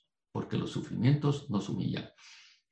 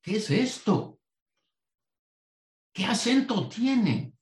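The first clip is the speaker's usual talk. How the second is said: mock astonishment; 8 semitones higher than usual.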